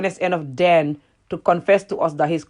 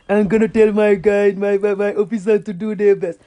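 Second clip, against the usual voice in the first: style self-important droning; mock president. Mimicking the president showing he is good, mocking. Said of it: "trying to show people that he's a good president".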